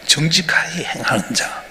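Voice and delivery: slow breathy whisper of the verse; hushed, solemn whisper. Acted. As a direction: lean in and whisper it slowly. Whispered scripture line in sermon.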